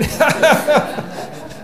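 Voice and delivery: breathy laugh at own joke. Real, not acted. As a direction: burst out laughing.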